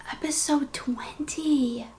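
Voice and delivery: slow breathy whisper. Real, not acted. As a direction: hush it in awe. Whispers it in awe.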